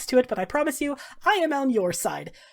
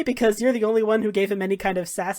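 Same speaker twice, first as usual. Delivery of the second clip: ordinary talk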